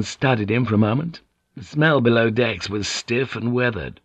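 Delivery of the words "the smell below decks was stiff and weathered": usual speaking voice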